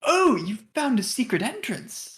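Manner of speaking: high, slow in-character amazement; acted wonder at a discovery; surprised. Game master plays Sildar, surprised.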